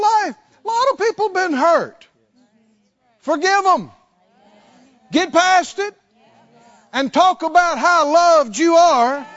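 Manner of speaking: high, loud, building sermon cry; full-force preaching. Acted. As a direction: drive it home to the whole room.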